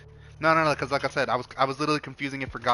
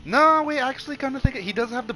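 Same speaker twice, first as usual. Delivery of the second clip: sudden realization; 11 semitones higher, 7 dB louder than usual. Real, not acted. Sudden realization mid-discussion.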